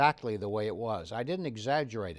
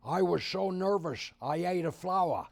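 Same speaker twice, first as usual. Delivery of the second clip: a light impression of an old comedian's voice, pitched up; deadpan old comic quip; George Burns; 4 semitones higher than usual. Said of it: "George Burns came up to me and he said".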